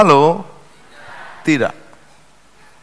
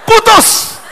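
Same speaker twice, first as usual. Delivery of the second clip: shrill threat; 4 dB louder than usual. Acted. Girlfriend threatens breakup.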